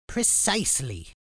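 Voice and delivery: high, nasal-sounding teacher whine; grating teacher voice; whiny voice. Acted. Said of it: "his whiny voice still grating".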